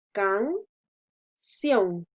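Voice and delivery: can-ción split by a long pause, weight on ción; syllable by syllable, stressed ending. Shown as a demonstration. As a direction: separate can-ción and land firmly on ción. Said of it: "La mayor fuerza de voz la hacemos en la sílaba ción". (can-ción separado en sílabas, con fuerza en ción)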